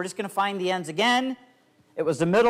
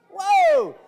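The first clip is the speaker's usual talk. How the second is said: high, loud showman whoa; big whoa; 7 dB louder than usual. Acted. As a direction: shout it like a stage reveal. Trick reveal to child.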